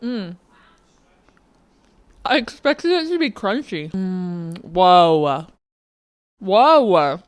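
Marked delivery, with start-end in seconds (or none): 4.6-7.2 loud amazed whoa; genuinely wowed by the taste; surprised whoa. Real, not acted. First bite of ice cream.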